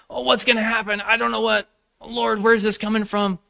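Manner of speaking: high, loud fretting; mock worry. Preacher mocking his own worried prayer to the Lord, high and fretful. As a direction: whine anxiously like a doubter.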